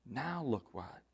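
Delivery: ordinary delivery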